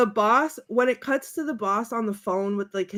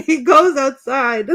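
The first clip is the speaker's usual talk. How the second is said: ordinary talk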